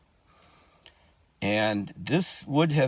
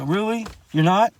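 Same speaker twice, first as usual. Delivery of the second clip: retold disbelief; 10 semitones higher, 6 dB louder than usual. He was like.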